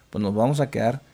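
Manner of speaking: ordinary delivery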